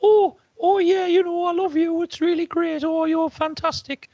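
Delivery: very high, loud, slow fawning squeal; gushing high potato voice. Acted. Comic doing his sweet potato bit to the crowd, high gushing voice. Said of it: "And I'm doing it, and I'm going".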